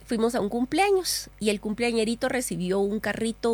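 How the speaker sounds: usual speaking voice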